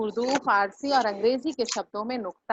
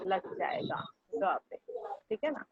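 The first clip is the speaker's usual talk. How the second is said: whisper; 7 semitones higher, 10 dB quieter, slower than usual. Real, not acted. Teacher drops voice mid-explanation.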